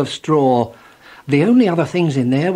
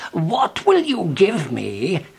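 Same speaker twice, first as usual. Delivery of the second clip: strange little man voice; 2 semitones higher, slower than usual. A narrator's reading. Mannikin voiced in story.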